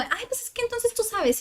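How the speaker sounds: high-pitched mock know-it-all tone; lightly hammed-up therapist voice; mocking psychologists. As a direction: parody colleagues who say you already know. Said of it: "empiezan como".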